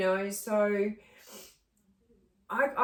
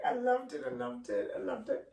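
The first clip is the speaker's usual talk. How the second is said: fading sobs; 3 semitones higher than usual. Real, not acted. Breaking down over stolen bike.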